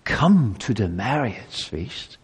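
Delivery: "Come to the marriage feast" pleaded softly and slowly; pleading. Acted. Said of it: "to plead with you".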